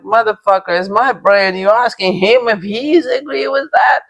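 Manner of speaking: indignant retold outburst, pitch climbing high; replaying the anger, voice rising and cracking; furious quote from the retelling, held near normal loudness; retold outrage.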